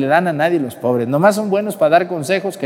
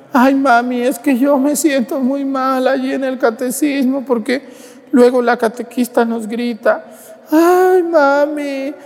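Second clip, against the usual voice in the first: put-on whiny child; 8 semitones higher than usual. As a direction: beg mom to stay home. Child whining to mother. (niño quejumbroso con voz aguda a su mamá)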